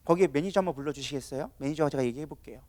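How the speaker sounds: low, soft, controlled request; calm composure. Acted. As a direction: ask politely, keeping your temper. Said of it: "컨트롤하는 사람들은 그렇게 하지 않아요". (차분하고 정중한 요청)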